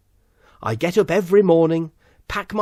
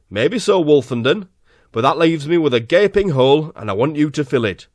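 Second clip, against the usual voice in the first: louder, booming boss voice; 6 dB louder than usual. Acted. Lord Director offers Wolfenden the job, booming. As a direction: lay it on like a blustering boss.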